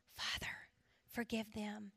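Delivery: near-whispered plea; low, hushed quotation of a prayer. Retold or imitated. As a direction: pray it very softly. Jesus on the cross, quoted.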